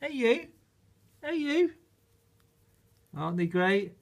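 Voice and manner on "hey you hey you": puppy talk. Real, not acted. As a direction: coo up high at the little dog. Cooing at the new puppies.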